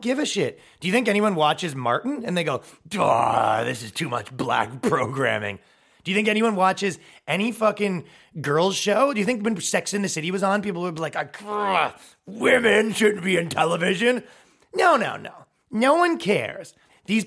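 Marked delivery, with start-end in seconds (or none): 2.9-5.6 slow, low, lumbering oaf voice; mock dopey complainer. Viewer supposedly complaining about Martin, mock dopey outrage. 11.2-14.2 high, growling caricature of outrage; mock enraged viewer. Viewer supposedly raging at Sex in the City, mock fury.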